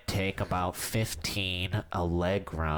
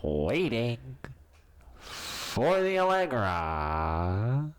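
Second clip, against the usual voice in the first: mock announcer; 2 semitones lower than usual. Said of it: "You have a good voice".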